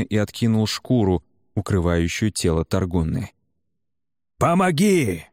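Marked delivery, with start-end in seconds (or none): 4.4-5.2 high-pitched call for help; cried out. A narrator's reading. Man calls his unmoving brother to help, crying out. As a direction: call out urgently, pitch leaping up. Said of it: "крикнул он".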